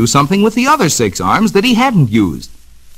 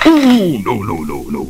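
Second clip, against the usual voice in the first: dismayed ooh; 3 semitones lower than usual. Acted. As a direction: wince at the sour note, then shake it off. Turtle shell out of tune.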